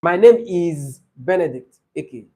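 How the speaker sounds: slow and deliberate self-introduction; slow, names separated. Shown as a demonstration. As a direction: space out each name so it can be counted. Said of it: "please say them slowly".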